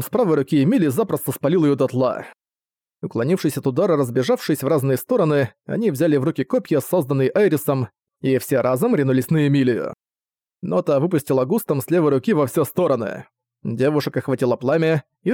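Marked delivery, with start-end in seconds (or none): none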